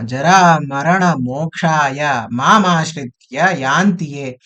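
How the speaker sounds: slow, measured chanting; Sanskrit recitation. Acted. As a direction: intone the verse slowly. Recites the verse to the group.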